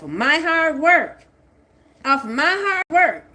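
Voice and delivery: shouting down the people who stole her work; furious shout. Real, not acted. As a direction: yell it at the thieves who robbed you. Furious rant at those who stole.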